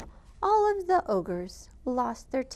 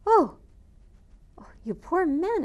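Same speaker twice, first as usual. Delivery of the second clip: high, loud cry of pity; exclaiming; 7 semitones higher, 5 dB louder than usual. Acted. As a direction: gasp out in shock and pity. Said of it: "exclaimed Zeralda".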